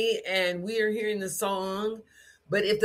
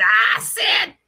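loud high barked command; military voice; 4 semitones higher, 9 dB louder than usual. Retold or imitated. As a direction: shout the name like a drill sergeant. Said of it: "a military voice".

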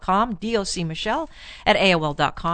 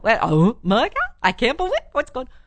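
mock emotional exclaiming; many-toned emotional talk; 2 semitones higher than usual. Shown as a demonstration. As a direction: exaggerate the tones like a gushing woman. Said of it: "We use about five tones".

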